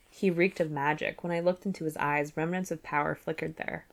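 plain reading voice

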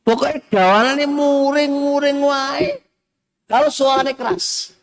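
high, mock whiny grumble; mock grumbling spouse. Preacher to congregation, mimicking a grumbling spouse in a high mock whine. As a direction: play the nagging spouse, pitched high.

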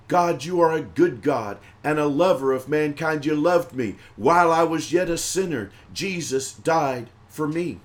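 ordinary talk, nothing marked

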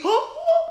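a high, hammed-up gasp of shock; mock gasp. Host mock-gasps at his podcast take, hammed-up gasp. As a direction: gasp like the news is scandalous.

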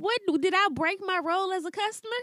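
high, mock-polite manager.

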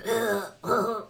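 demonstrated winded huffing; unrestrained panting. Shown as a demonstration. Acting out climbing stairs alone, unrestrained panting. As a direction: huff and puff openly. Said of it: "when I'm by myself, I'm like".

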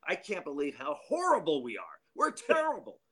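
high, mock disgusted complaint; imitating a manager fed up with his team. Manager griping about his team, high and mock disgusted. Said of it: "He goes".

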